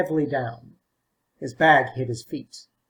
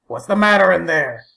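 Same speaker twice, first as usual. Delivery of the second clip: louder call through the door; 3 semitones higher, 7 dB louder than usual. A narrator's reading. Old man calls to the boy stuck in the loo, louder call. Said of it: "he called".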